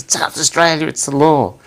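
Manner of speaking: high, loud, scandalised protest; shocked. Retold or imitated. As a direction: imitate someone aghast at a rule-breaker. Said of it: "it's like shocked".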